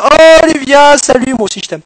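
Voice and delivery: put-on high, loud swooning girl voice; girly voice. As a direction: gush like a girl in love. Answers himself as Olivia. (voix de fille énamourée)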